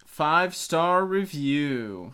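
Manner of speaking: slow. Pretend. Jokingly chiming in slowly.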